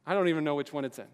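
ordinary talk, nothing marked

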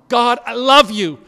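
loud, high cry of devotion; overwhelmed love. Acted. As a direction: burst out to God, overcome. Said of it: "a human that is overwhelmed".

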